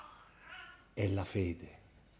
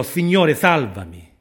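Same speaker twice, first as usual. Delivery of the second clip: loud, high cry for help; narrator's full-voiced shout, falling at the end; desperate cry; 5 semitones higher, 9 dB louder than usual. A narrator's reading. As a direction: cry out suddenly to be saved. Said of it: "Gridò".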